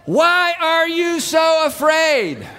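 very slow, high holler; long stretched high yell; yelled question. Acted. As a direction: shout it word by word over the storm. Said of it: "He's having to yell this".

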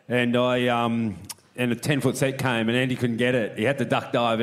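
usual speaking voice

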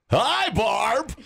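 match her energy; energetic. Real, not acted. Host greeting chipper guest Barb, peppy. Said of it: "bring my energy level up".

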